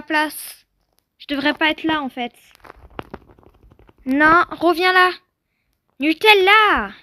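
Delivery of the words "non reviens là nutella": loud call after a runaway horse; loud call to a bolting horse. Real, not acted. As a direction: shout the name across the field. Horse runs off, calling it.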